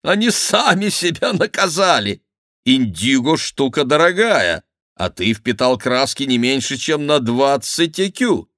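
high, lively mockery; amused teasing courtier. Favourite teases his dyed friend over costly indigo, high glee.

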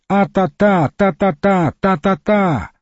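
high-pitched calling without much volume; restrained, high mock shouting; narrated cry. A narrator's reading. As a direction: call out high in repeated bursts. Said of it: "Вдруг Дерсу принялся кричать".

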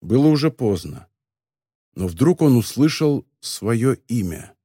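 plain reading voice

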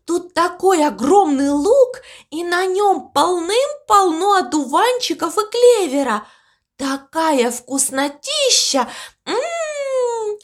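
high, savouring relish; gushing delight. A narrator's reading. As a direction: gush over a delicious feast, humming "mmm". Teasing Mopsi with a tasty feast.

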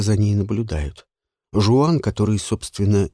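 plain reading voice

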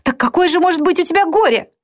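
put-on high, anguished young sister; distraught girl lashing out at her sister; Marianne voiced. (высокий, страдающий голос Марианны)

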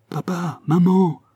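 high little voice, second word loud; child calling out. A narrator's reading. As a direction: call out like a boy coming nearer. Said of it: "répétait la voix en se rapprochant de plus en plus".

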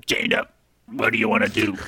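bubbly underwater character voice. Acted. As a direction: talk like you're blowing bubbles. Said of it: "That's my bubbly voice".

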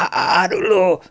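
high, strained cry while struggling; gasped accusation. A narrator's reading. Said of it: "zihálta Ridzett".

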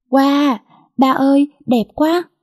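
put-on high, slow child's gasp of delight; delighted cry. A narrator's reading. Girl thrilled by father's gift, high delighted cry. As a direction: squeal with joy at the new shoes. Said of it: "Nó kêu lên một cách sung sướng".

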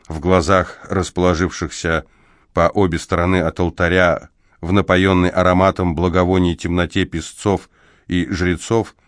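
ordinary delivery, nothing marked

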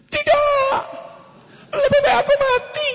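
high wailing daughter's cry; dramatic daughter. Acted. Daughter refuses the arranged husband, high wailing cry. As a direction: melodramatic high protest.

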